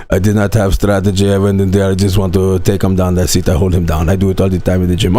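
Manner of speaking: put-on very low, thick foreign accent; terrible Hamzat impersonation. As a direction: do a bad, deep-voiced fighter with accented English. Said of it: "doing a terrible fucking impersonation".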